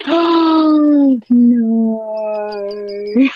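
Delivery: long drawn-out, loud oh no; stretched-out cry of disbelief; gasping dismay. Real, not acted. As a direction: gasp and wail it in amused horror. Reacting to undercover cop reveal.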